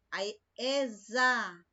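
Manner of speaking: "exa" drawn out slowly, the X buzzing like a Z; slow syllable. Shown as a demonstration. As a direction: stretch "exa" so the Z sound shows. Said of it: "Za é um som de Z". ("exa" bem devagar, com som de Z)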